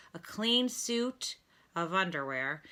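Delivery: plain reading voice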